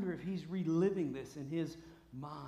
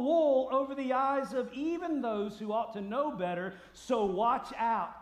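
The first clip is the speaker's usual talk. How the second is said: loud, raised preacher's voice; forceful preaching warning; 7 semitones higher, 9 dB louder than usual. Real, not acted. Preacher warning the church about fake messiahs, forceful alarm. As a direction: warn them urgently and emphatically.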